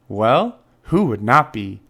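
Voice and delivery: ordinary delivery, nothing marked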